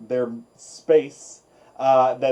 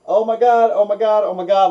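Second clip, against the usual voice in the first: excited gushing; 7 semitones higher, 6 dB louder, faster than usual. Real, not acted. Gushing over the episode.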